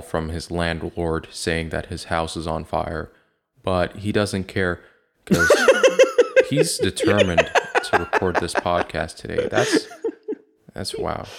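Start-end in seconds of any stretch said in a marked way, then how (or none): 5.6-6.5 loud laugh. Real, not acted. Cohost laughing at Steven ignoring the fire, loud laugh. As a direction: burst of high laughter.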